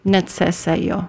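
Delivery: "Necesejo" slowly, C said as TS, "net-seh-SAY-yo". Shown as a demonstration. Guest correcting host's "Necesejo", slow demo of C as TS. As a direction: model the TS sound in "Necesejo", slow and careful. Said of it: "pronounced like a T S sound".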